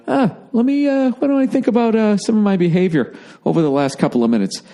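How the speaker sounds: mock the chastened guy pondering his behavior; self-reflective slapped guy. Slapped man, musing after the sting, in a high put-on voice. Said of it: "There is kind of a".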